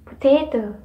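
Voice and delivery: "potato" with a loud, emphatic TA; syllable stress. Shown as a demonstration. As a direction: punch the middle syllable: "po-TA-to". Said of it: "usually louder".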